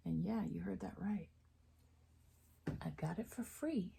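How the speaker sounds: hushed, conspiratorial aside; secret whisper. Real, not acted. Telling viewers she got it free, in a whisper.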